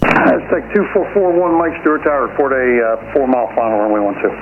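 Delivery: air traffic control radio. Real, not acted. Tower instruction over the radio.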